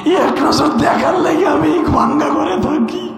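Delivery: high, slow, wailing cry of a mother; mournful sermon wail. Acted. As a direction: cry it out as a pleading old mother. Mother's pleading quote, wailed.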